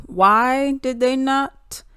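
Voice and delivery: ordinary delivery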